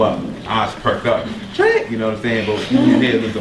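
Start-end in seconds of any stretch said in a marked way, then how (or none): 1.5-1.9 very high excited yelp; mock fan squeal at the name. Crowd excited by [name].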